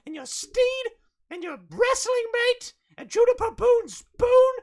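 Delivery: put-on high, loud whiny kid; whiny child rant. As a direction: rant like an outraged little boy. [name] ranting.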